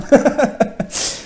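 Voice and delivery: high-pitched chuckle at his own pun; laugh. Real, not acted. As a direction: laugh at your own silly joke. (petit rire aigu après son jeu de mots)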